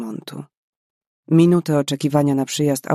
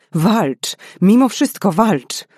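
rising to a loud, higher, slower plea on Mimo; desperate cry; 5 semitones higher, 5 dB louder, slower than usual. Acted. Karolina to the dying girl, desperate building cry. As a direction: drag it out, begging her to fight.

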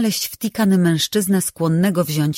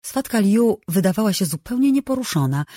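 plain reading voice

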